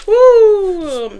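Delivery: high, drawn-out woo; long high-pitched "woo"; loud whoop. Real, not acted. Whoops after greeting viewers.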